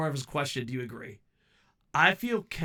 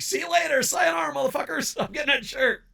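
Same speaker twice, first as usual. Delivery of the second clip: high, loud giddy goodbye; excited shout; 12 semitones higher, 6 dB louder than usual. Retold or imitated. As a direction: ditch your friends gleefully.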